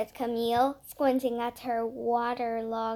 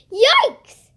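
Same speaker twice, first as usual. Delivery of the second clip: much higher, much louder alarmed squeal; startled yelp; 14 semitones higher, 18 dB louder than usual. A narrator's reading. Emerson protecting her rubber chicken, startled squeal. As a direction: yelp as if grabbed.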